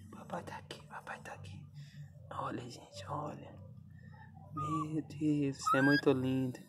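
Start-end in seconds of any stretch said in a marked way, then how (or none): 0.1-3.6 whisper. Real, not acted. Soothing dog in whispers.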